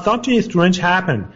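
ordinary delivery

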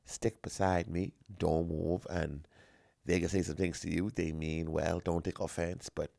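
low, soft confidential warning; hushed warning. Retold or imitated. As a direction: murmur the warning close to her ear. Warning girlfriend before meeting family.

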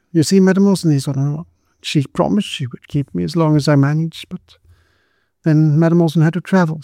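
put-on lightly tearful poor girl; young servant girl. Karen's voice held in story.

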